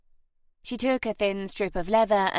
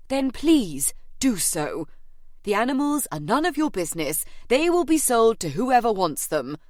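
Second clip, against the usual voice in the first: agent's firm, emphatic dismissal; put-on voice, stern and raised; exasperated; 4 semitones higher, 4 dB louder than usual. A narrator's reading. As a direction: order her off, out of patience. Exasperated agent orders her out.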